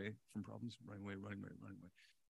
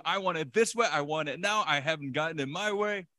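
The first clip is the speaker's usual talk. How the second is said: loud, high mock tantrum; bratty child; 5 semitones higher, 8 dB louder than usual. Preacher voices the bratty child within, mock demanding tantrum. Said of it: "that bratty child within that's always going".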